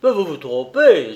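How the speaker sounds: loud, agitated protest; exasperated exclamation; growing irritation. A narrator's reading. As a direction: burst out, starting to lose patience. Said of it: "qui commençait à s'énerver".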